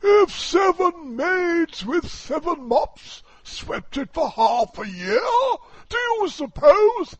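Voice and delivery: high, loud, booming walrus; a pompous walrus declaiming loudly. Acted. Walrus voiced in poem.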